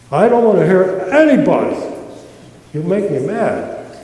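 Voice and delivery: ordinary talk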